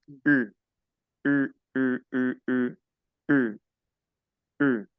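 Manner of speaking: short separate U's, lips rounded as for French. Shown as a demonstration. Teacher models the French U for Spanish-speaking learners, rounded U. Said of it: "se obtiene colocando los labios redondos".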